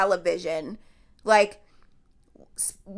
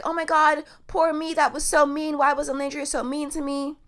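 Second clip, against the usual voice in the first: higher, self-pitying mock whine; mock poor-me whine; 6 semitones higher than usual. Vlogger mocks Huda's poor-me rant in a higher whining voice. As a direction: ham up the victim act, pitched up. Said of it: "she's going on this huge".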